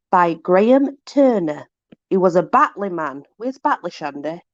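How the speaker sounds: ordinary talk, nothing marked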